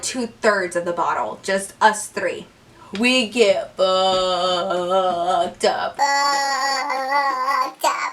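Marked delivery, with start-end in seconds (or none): 3.0-6.0 very slow, low, stretched word; drawn-out emphasis. Shown as a demonstration. Three friends finish the bottle, drawn-out emphasis. 6.0-8.1 long held, sung-like note; drawn-out drone. Shown as a demonstration. Repeating how drunk they got, drawn-out drone.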